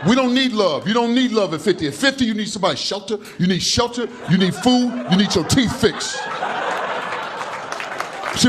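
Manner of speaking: ordinary talk, nothing marked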